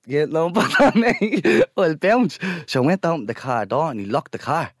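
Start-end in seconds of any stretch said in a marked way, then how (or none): 0.1-2.4 laughing nervously. Retold or imitated. Laughing at the angry driver.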